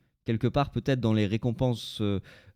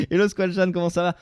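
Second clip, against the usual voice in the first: put-on louder, higher Marseille accent; 6 semitones higher, 5 dB louder than usual. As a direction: ham up a southern Marseille accent. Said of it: "avec un accent marseillais".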